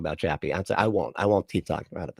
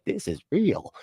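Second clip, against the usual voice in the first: higher-pitched mock conviction; mock emphatic; 7 semitones higher than usual. Streamer imitating a believer on stage, mock emphatic. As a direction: declare it like a stage testimony, imitated. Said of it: "really emphatically is like".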